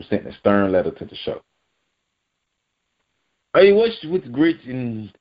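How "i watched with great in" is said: slow, high attempt at a French accent; bad French accent. Vlogger starts reading the French ambassador's letter, bad French accent. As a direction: put on a poor French accent, drawn out. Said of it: "I can't do a good French accent".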